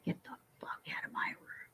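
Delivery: hushed, breathy telling-off; whispered. Retold or imitated. To the hospital doctors, a whispered get-out. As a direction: hiss it low, barely voiced.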